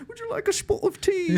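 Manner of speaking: very high cartoon British tea offer; sing-out parody British, high and silly; mock British accent. Mocking his sudden slip into British, hammed-up accent. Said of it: "Just so you know, I'm British".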